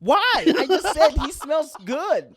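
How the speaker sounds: loud, high-pitched disbelief; real exasperated outburst; indignant protest. Real, not acted. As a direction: protest your innocence at full pitch, drawn out. Indignant defence amid friendly teasing.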